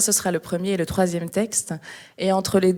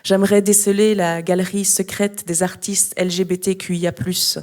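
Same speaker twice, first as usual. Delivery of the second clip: louder, measured recital with pauses between phrases; slam poetry reading; 6 dB louder than usual. Acted. Slam poet reading a museum text to an audience, poetry recital. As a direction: declaim each phrase, leaving space after it.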